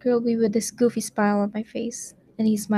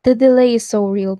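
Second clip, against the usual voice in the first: louder, higher on the first go; loud exasperated exclaim; 4 semitones higher, 9 dB louder than usual. Real, not acted. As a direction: shout it out, exasperated by the lag. Exclaiming about stream delay.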